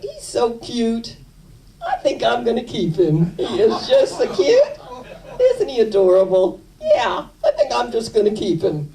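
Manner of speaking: high, cooing, giggly voice over a cute dog; high delighted baby-talk gush; gushing neighbor. Retold or imitated. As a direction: coo and giggle like she's smitten.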